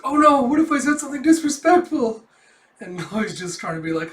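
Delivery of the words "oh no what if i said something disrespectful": loud, high hammed-up worry; comic panic. Yor fearing she was disrespectful, hammed-up panic. Acting a panicked manga character.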